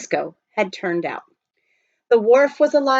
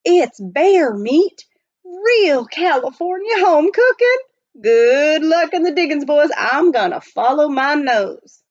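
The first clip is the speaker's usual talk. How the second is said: higher, louder backwoods voice; Mountain Jim voiced; 7 semitones higher, 6 dB louder than usual. A narrator's reading. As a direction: relish the smell of home cooking.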